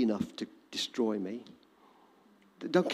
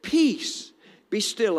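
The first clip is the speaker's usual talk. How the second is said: gentle command; 5 semitones higher, much slower than usual. Acted. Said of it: "one of our translations says".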